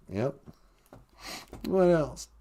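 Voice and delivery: normal talking voice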